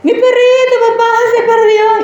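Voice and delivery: a daughter cries to her dad about her lost puppy; tearful child. Retold or imitated. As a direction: sob it to your dad like a small girl. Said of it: "escandalosamente llorando".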